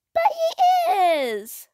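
put-on high, loud, drawn-out child's protest; wailed childish protest; crying out. A narrator's reading. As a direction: cry out with the last word stretched. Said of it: "Sophie cried".

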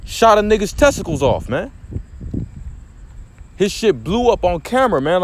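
ordinary talk, nothing marked

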